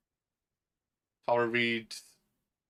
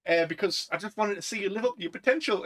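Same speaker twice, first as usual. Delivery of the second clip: quoting the professor; 6 semitones higher than usual. Retold or imitated. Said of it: "and he's like".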